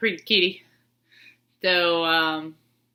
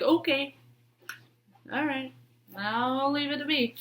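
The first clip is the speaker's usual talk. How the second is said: voicing the cat; 7 semitones higher, 5 dB quieter than usual. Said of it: "she got the, the pillow like".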